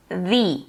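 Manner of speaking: "the" with a long ee vowel; THE before a vowel. Shown as a demonstration. English teacher showing "the" before vowel sounds, pronounced "thee". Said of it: "vai ser pronunciado como".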